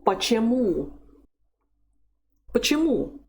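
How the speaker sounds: teacher's model Почему, punching the last syllable; weak "pa" then strong high-to-falling "MU" in Почему; stress on final U. Shown as a demonstration. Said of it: "the stress is over the letter U".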